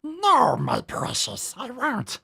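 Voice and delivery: high, hissing Gollum impression. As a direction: do Gollum clutching the ring. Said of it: "It's like Lord of the Rings".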